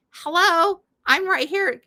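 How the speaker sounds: exasperated mock callout; mock indignation. Mock scolding of texting kids.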